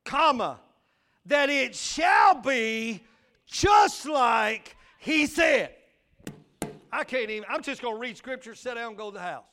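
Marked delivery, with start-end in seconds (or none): 0.0-5.8 loud, slow, drawn-out preaching; preaching at full force. Acted. Preacher reading scripture to the church, loud and drawn-out. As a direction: stretch every word like a sermon peak.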